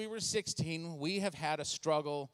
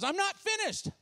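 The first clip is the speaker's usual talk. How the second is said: whiny daughter; 13 semitones higher, 4 dB louder than usual. Retold or imitated. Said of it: "She goes".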